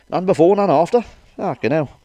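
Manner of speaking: ordinary talk, nothing marked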